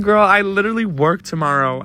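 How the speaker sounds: high-pitched retold protest; whiny, high dismay; exasperated protest. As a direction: plead your case, exasperated and high. Retold protest to friend at arrest.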